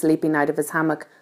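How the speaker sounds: ordinary delivery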